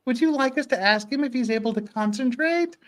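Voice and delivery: ordinary talk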